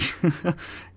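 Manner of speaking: breathless nervous laugh; a quick nervous laugh to cover a lie. Acted. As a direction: clerk laughing off a lie to his boss. Nervous laugh after lying.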